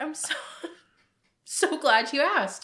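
high, loud, laughing "so glad you asked"; mock gratitude through a laugh; laughing sarcasm. Sarcastic quoted reply.

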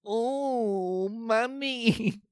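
put-on high, thin eerie child voice; creepy kid voice. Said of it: "That's my, that's my kid's voice".

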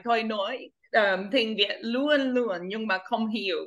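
a quick self-mocking line, "Tôi nói, tiếng Việt luôn luôn, nhưng mà không hiểu", said in Vietnamese; spoken Vietnamese. Shown as a demonstration. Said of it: "I say this in Vietnamese all the time".

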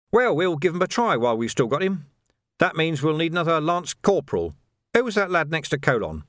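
lightly voiced sergeant-at-arms; businesslike superior. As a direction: consult a subordinate as an equal. Vimes consults his sergeant.

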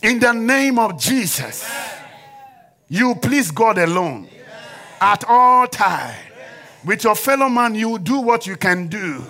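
loud, slow, high declaration; emphatic preaching. Real, not acted. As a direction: pronounce it over the congregation.

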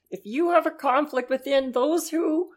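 pompous lecture on hierarchy; Jordan Peterson impression. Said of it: "scarily good impressions from both of you".

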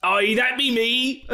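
high, slow Irish pirate brogue; pirate Seamus. Retold or imitated. Pirate Seamus answering the barista, hammed-up brogue. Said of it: "call out your name in coffee shops".

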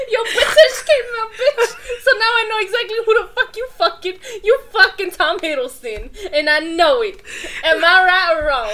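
words pushed out through laughter, high and loud; cracking up while teasing; laughing. Real, not acted. Teasing her friend about a crush, laughing hard.

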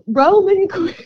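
high, drawn British accent on the name; British accent, played up. Shown as a demonstration. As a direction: announce it like a British F1 driver. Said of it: "I keep saying it".

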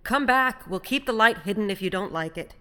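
loud, urgent call back. A narrator's reading. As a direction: call across the water to bring him back. Calling the departing stranger back.

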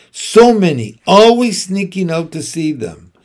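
high, loud gleeful tease; loud teasing. Acted. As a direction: tease with glee. Halmoni teasing loudly.

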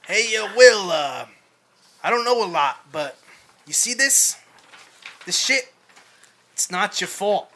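put-on Boston accent. Therapist pressing the young man in an overdone Boston accent. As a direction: ham up a Boston therapist, laughing at points. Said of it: "Should we do it all in a Boston accent".

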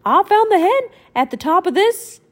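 high, loud mouse voice; squeaky mouse voice; Mickey voice. Acted. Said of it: "said Mickey".

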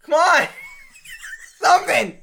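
loud, pleading "Come on!"; loud exasperated shout. Retold or imitated. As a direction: belt it out in disbelief. Repeating his plea louder.

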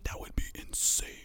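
tense hushed whisper; whispered hope under the breath. Real, not acted. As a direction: breathe it like you dare not jinx it. Hushed hope about game comeback.

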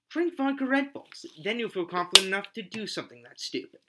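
a parody sponsor spot for an energy drink; mock ad read. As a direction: sell it like a cheesy radio ad.

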